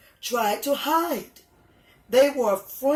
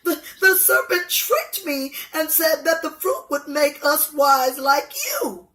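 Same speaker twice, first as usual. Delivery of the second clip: higher and louder, a touch of crying at the end; tearful Eve; 6 semitones higher, 5 dB louder than usual. Acted. Eve blaming the serpent before God, mildly tearful. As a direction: plead with a catch of tears. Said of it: "Eve replied".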